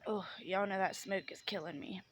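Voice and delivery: ordinary delivery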